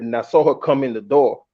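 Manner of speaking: ordinary delivery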